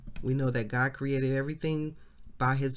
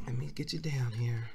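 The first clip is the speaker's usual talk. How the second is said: whispered aside; 5 dB quieter than usual. Real, not acted. Aside while moving the camera.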